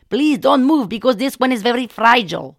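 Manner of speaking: put-on loud, slow Indian-accented plea; urgent healer warning. Healer warns patient about fragile back, urgent.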